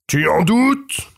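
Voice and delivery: high, slow, challenging ogre; ogre roars. A narrator's reading. Ogre challenged about the mouse, slow high indignant retort. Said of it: "rugit l'ogre".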